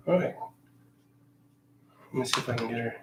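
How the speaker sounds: ordinary delivery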